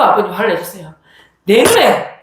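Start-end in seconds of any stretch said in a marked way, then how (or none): 1.4-2.1 louder, higher imitated father's yell; retold shout of a furious father. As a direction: bark it like an angry father. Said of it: "라고 소리를 지셨".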